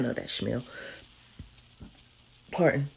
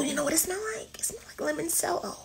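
whisper; 11 semitones higher, 10 dB quieter, faster than usual. Real, not acted. Whispered reveal of the scent.

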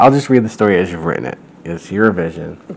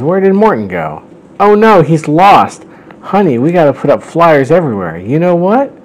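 high-pitched fretting dad, hammed up; mock alarm, pitched right up; panicked Bowser; 7 semitones higher, 3 dB louder than usual. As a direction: act out a frantic parent in a higher voice. Acting Bowser panicking over lost son.

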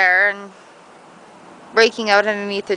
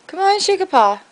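higher coaxing to the dog; 9 semitones higher than usual. Real, not acted. Owner coaxing the dog to shake a paw, high coaxing.

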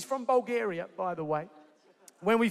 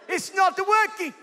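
higher, comic attempt at a Bulgarian accent; rough Bulgarian accent; 5 semitones higher than usual. Husband replays wife's complaint, higher mock Bulgarian accent. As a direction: imitate an annoyed Bulgarian speaker, pitched up. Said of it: "I don't know if that's a Bulgarian accent".

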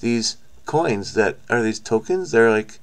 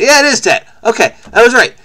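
excited; 10 semitones higher, 7 dB louder than usual. Real, not acted. Delighted at being right.

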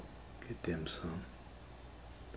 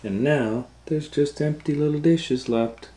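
higher and louder, amazed; surprised; 9 semitones higher, 6 dB louder than usual. Acted. Reading to children, amazed the dishes are empty. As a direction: exclaim it with wide-eyed wonder.

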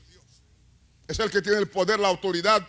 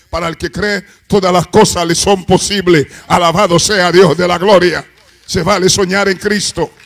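roaring, much louder preaching; preaching at full force; 19 dB louder than usual. Real, not acted. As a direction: shout the promise to the whole church. Predicación a plena fuerza. (predicando a todo pulmón)

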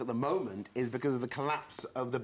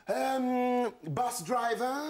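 high, drawn-out mock pondering; careers adviser; 9 semitones higher, 4 dB louder, much slower than usual. Careers adviser sizing up a pupil, high mock pondering. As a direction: mimic an adviser loudly hesitating, then guessing. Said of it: "he looked me in the eye and he went".

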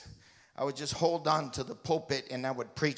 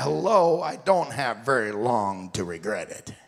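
loud retort; 3 semitones lower, 8 dB louder than usual. Retold or imitated. His retort to wife.

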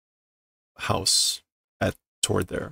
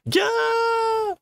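panicked screams. Acted. Girl panicking at the monster at the door, high shrieking yells.

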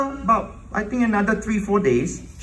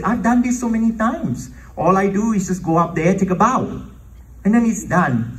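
audacious protest; 7 semitones higher than usual. Retold or imitated. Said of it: "I had the audacity to tell the Lord".

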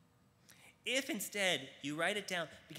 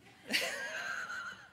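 burst of laughter. Real, not acted. Laughs at own joke.

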